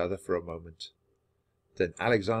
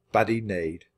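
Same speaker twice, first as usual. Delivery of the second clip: ordinary delivery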